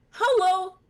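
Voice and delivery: very high, loud, bright greeting; high squeaky fish girl. Acted. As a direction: squeal hello like a cartoon fish. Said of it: "this voice more distinct".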